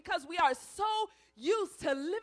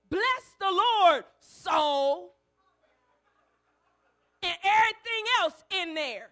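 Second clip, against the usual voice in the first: commanding soul; 7 dB louder than usual. Retold or imitated. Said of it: "David had to command his soul".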